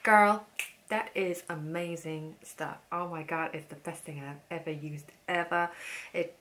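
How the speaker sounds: imitating the salesman's gushing American accent, hammed up; over-the-top impression of a gushing salesman; mock shop assistant accent. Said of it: "I'm just insulting him, this man, with my accent".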